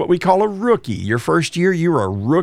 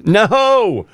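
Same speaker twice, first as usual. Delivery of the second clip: ordinary talk, nothing marked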